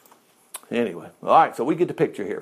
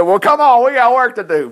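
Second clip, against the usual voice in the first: eager call; 7 semitones higher, 6 dB louder than usual. Acted. Joshua urging the angel on.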